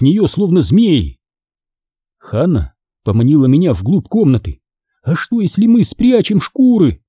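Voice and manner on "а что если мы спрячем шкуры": high, eager, voiced suggestion; a touch of conspiratorial excitement; excited stage whisper. A narrator's reading. Hanna proposing to hide the skins, excited. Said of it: "возбуждённо прошептала она".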